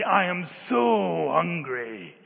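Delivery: "so" drawn out long and loud in a slow whine of hunger; emphatic child's complaint. Retold or imitated. Children come to their parent to say they are hungry, heavy emphasis on "so". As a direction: stretch "so" right out to stress the hunger. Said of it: "put an emphasis on it".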